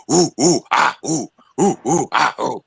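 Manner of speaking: mocking the mountain tribe's hooting war cry; mock ape hooting. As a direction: hoot like a mountain tribe warrior. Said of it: "they just start going".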